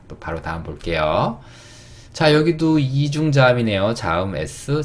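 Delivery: usual speaking voice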